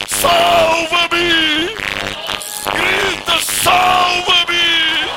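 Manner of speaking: pleading sermon cry, building from high speech to shouts; fiery preaching. Acted. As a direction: preach at full force, crying out to Jesus.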